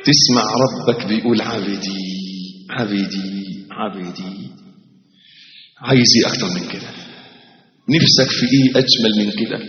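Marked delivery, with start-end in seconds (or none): 1.4-4.4 much softer each time, almost gone; tender fading call. Acted. Preacher voicing God calling his servant, fading soft call. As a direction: call out tenderly, letting it fade away.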